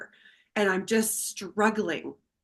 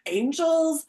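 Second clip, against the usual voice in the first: laughing plea; 6 semitones higher, 4 dB louder than usual. Retold or imitated. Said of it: "And I was like".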